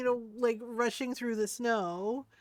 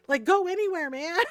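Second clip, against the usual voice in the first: exasperated call to dog; 8 semitones higher, 7 dB louder than usual. Retold or imitated. Urging dog in the cold.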